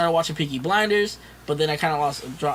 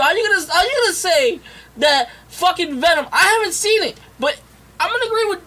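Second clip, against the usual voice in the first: exasperated teasing, pitched way up; high mock rant; 11 semitones higher, 4 dB louder than usual. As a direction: keep the rant going up high and loud.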